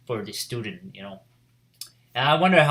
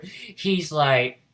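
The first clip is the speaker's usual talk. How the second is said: ordinary talk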